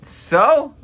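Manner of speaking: scoffing back at the hyped-up crowd; unimpressed retort. Retold or imitated. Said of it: "I'm like".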